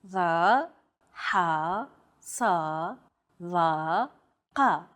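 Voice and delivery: "Va, ha, sa, va, qa" from deep within the throat; tense, constricted throat on each of "Va, ha, sa, va, qa"; isolated syllables "Va, ha, sa, va, qa", throat tightened; emphatic consonants. Shown as a demonstration. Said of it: "much more tense because the throat is constricted".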